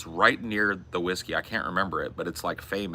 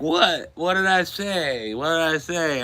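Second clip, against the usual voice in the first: confused; 10 semitones higher, 4 dB louder, slower than usual. Retold or imitated. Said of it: "I was like".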